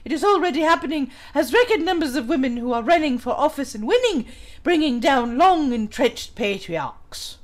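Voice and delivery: put-on English accent, reading aloud. Streamer reading an article on patriarchy aloud, English accent. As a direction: read it out in a mock English accent. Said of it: "when I'm in my English accent".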